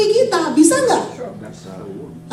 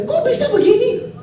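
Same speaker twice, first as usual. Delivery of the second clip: high-pitched, testy questioning; playfully acted exasperation; mock emotional complaint; 9 semitones higher than usual. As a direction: act worked up, pitch jumping high. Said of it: "jadi kalau misalnya pas saya".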